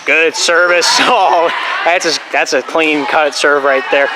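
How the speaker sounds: usual speaking voice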